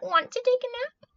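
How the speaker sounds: very high little cat voice; cat character. A narrator's reading. Cat inviting the spider to nap, high cat voice. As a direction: ask it in a high kitty voice. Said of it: "cried the cat".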